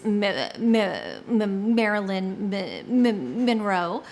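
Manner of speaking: repeated M sound blocks; stuck on the letter M; stuttering. Real, not acted. Stuttering on her name.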